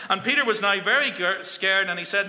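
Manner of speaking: ordinary talk, nothing marked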